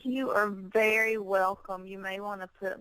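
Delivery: ordinary talk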